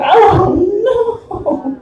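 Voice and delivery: high rising horrified wail; mock dismay. Comic retelling poop falling on the floor, high wail. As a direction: squeal it as disaster strikes.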